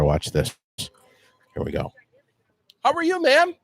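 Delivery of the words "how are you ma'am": sweet high greeting. Acted. Host greets lady in sweet voice.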